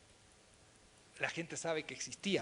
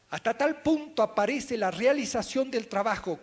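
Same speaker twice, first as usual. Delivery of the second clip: loud, higher quote reading at normal pace; lectura enfática; 5 semitones higher, 7 dB louder than usual. Shown as a demonstration. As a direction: read the quote out strongly, without slowing. Lee la cita a ritmo corrido. (lectura enfática en voz alta, a ritmo normal)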